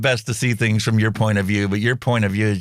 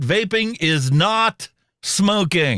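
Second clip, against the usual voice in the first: mock announcement; 7 semitones higher, much slower than usual. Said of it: "Public service announcement".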